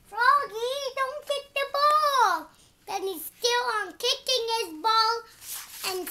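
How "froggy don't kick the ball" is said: loud call telling him to stop; shouted warning. Acted. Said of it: "Then he said".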